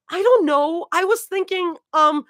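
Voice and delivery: flustered. Acted. Flustered character, high pitch.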